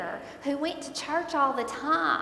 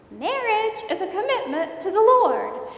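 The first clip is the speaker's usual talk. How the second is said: put-on higher, louder old church lady voice, with a laugh; little old lady; 9 semitones higher, 7 dB louder than usual. Bible study lady repeating her advice, higher and louder. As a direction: mimic a sweet persistent old lady. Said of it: "little old lady from my Bible study".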